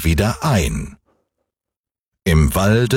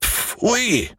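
high, softer exclamation of disgust; scolding old moon; 13 semitones higher, 5 dB quieter than usual. A narrator's reading. Moon after the boy rode over his nose, high disgusted exclamation. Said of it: "sagte der Mond".

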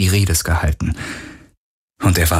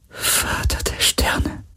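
very slow, soft whisper, drawn out; whispered repetition; 6 dB quieter, much slower than usual. A narrator's reading. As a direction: draw each word out in a faint, awed whisper. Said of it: "flüsterte Kirai". (ganz langsam, gedehnt geflüstert)

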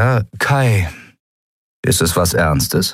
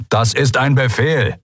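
raised-pitch, stern order; thundered command; 7 semitones higher than usual. A narrator's reading. The admiral orders Kai to sit, thundering. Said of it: "donnerte der Admiral".

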